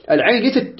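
usual speaking voice

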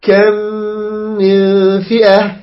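slow hum on meem, shorter on noon; measured ghunnah in كم من فئة. Shown as a demonstration. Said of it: "مثلًا في قوله تعالى".

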